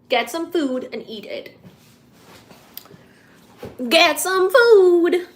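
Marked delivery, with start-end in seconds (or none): 3.9-5.3 high, slow, loud call. Acted. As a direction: call out "food" slowly and loudly. Urging viewers to eat.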